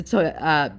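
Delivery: usual speaking voice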